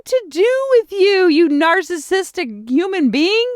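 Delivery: high-pitched mock self-scolding; beating herself up. Woman talking to herself over her made-up story, mock scolding. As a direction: berate yourself, pitch climbing in disbelief. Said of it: "like, beating myself".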